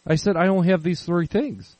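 high-pitched, startled protest; retold disbelief. Shopper retells disputing the cashier's total, disbelieving.

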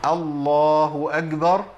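slow loud call, أكبر said as agbar; drawn-out call to prayer, breathless kaf in أكبر; muezzin's faulty kaf. Acted. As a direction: chant الله أكبر like a muezzin, g for k. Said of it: "بعض المؤذنين وهو بيقول".

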